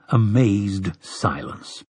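plain reading voice

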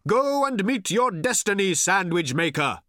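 high, projected rallying cry; shouted. A narrator's reading. Old man sending sandwich maker off, high shout. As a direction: shout a grand send-off. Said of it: "shouted Thrashbarg".

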